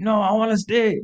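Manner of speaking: high-pitched mock whine; imitated drunken protest. Drunk friend resisting being carried off, imitated high whine. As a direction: refuse in a high, childish plea.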